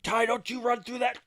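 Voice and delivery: put-on high, loud warning shout at a kid; a father yelling at his son not to run through the puddle; retold dad yell. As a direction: shout across the parking lot to stop him.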